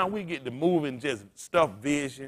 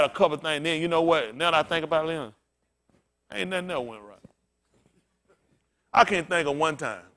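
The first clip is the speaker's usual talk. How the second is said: ordinary talk, nothing marked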